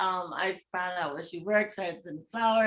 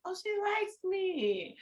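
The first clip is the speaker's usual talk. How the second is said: slow, higher surprised delight; delighted realization; 9 semitones higher, 4 dB quieter, slower than usual. Retold or imitated. When her date grabs her hand, slow delighted surprise. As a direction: let the discovery dawn slowly and high.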